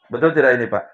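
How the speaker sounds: normal talking voice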